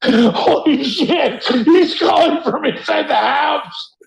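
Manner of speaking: high-pitched hammy panic; mock horror. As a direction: shriek like a horror victim. Mock horror movie scare.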